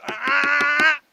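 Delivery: sharp high ah to stop the dog; correction sound. Shown as a demonstration. Trainer correcting dog jumping on counter, sharp high ah. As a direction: bark out a quick high ah to correct. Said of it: "when they put their paws up".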